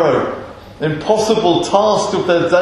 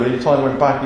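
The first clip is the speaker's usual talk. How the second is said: usual speaking voice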